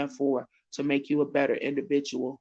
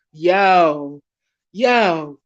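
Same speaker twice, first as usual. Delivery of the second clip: hyped shout-out; 4 semitones higher, 9 dB louder, slower than usual. Real, not acted. Energetic sign-off shout-outs.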